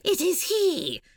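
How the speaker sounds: high, put-on eerie chant; creepy twins. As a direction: imitate creepy twins speaking in unison. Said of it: "Creepy blind twins are like".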